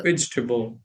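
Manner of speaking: "Vegetable" compressed to VEJ-tuh-bul. Shown as a demonstration. Learner repeats the corrected word, compressed pronunciation. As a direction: run the end of "Vegetable" together, low-key. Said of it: "like, faster".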